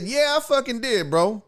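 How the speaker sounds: high-pitched, defiant retold reply; irritated comeback. Passenger answers driver about the phone, irritated. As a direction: answer back, riled.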